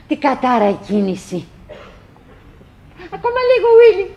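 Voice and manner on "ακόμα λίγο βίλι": high, lifted urging; eager encouragement. Acted. Winnie urging Willie to crawl the last bit. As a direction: cheer him on.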